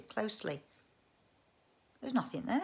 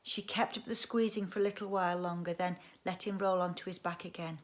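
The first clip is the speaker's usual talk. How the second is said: plain reading voice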